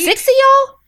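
high, loud disbelieving yelp; incredulous. Real, not acted. Reacting to six sisters, high loud disbelief. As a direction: shriek the question in disbelief.

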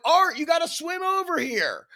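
high shout across the water; pleading shouted order; urgent high call. Retold or imitated. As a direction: call out to him to swim over. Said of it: "And I'm like".

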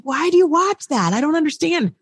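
baffled. Retold or imitated. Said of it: "I'm like".

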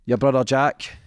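ordinary delivery

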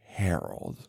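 very low, soft murmur; hushed quote. Retold or imitated. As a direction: imitate her saying the name, barely voiced. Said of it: "And she's like".

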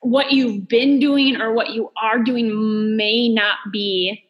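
ordinary delivery

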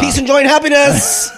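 high, bright greeting card cheer; sing-song joke. Pretend. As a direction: burst in with a cheesy holiday wish. Same joke repeated louder.

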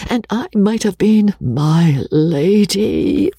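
high, wailing lament; woeful repentance. A narrator's reading. Rebecca regretting she might have been my lady, wailing. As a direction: bemoan the lost title, pitched high. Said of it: "in the woes of repentance".

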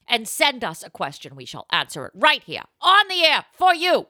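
put-on loud, high, pushy old-time ad voice; hammed-up ad read. Host plugs the show like an old toothpaste ad. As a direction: sell it like a fifties toothpaste ad.

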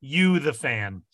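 slow, drawn-out dramatic reveal; slow down for the big reveal. Acted. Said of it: "you like how I did that?".

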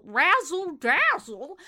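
ordinary delivery

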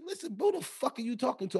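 threatening challenge. Retold or imitated.

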